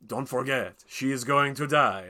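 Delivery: put-on made-up director's accent, slow and emphatic; mock a film director in an uncertain foreign accent; Baz Luhrmann. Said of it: "I don't know what his accent is".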